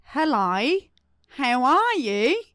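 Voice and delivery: slow, stretched-out Australian accent. Shown as a demonstration. Testing the guest on accents, deliberate Australian accent. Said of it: "That's Australia".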